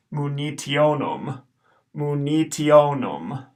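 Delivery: slow, mu ni tionum with a short final U; Latin pronunciation demo. Shown as a demonstration. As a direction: spell it out by ear, tionum short. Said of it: "the last U is short".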